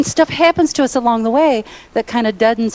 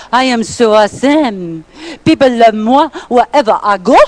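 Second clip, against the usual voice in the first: a light, playful French accent, louder at the end; fake French accent; 4 dB louder than usual. Shown as a demonstration. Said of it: "brag in a fake French accent".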